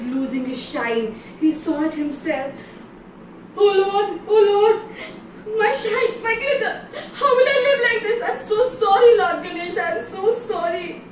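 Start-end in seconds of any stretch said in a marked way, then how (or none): 3.6-11.0 high, loud wailing apology; pleading wail, fast and tearful; crying plea. Acted. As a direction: beg the god through sobs. Moon begs, losing his shine.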